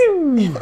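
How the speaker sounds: high "bling" sound effect, drawn out; falling cartoon-style zing; imitated zinging whoosh. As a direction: make a playful vocal zoom noise. Repeats the imitated noise.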